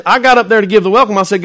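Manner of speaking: normal talking voice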